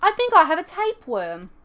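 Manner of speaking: put-on London urchin accent. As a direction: play the starving little London street urchin. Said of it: "my little urchin accent".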